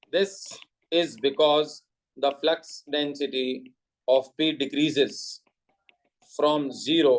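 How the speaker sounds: very slow, word-by-word dictation; spaced-out note-giving, with long pauses. Real, not acted. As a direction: read it out slowly for students to write down. Dictates notes to the class.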